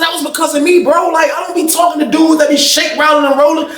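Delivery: high-pitched insistence; retold indignation. Inmate tells the officer who kept him safe, retold indignation. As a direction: press the point, voice pitched right up. Acted quarrel, claiming credit.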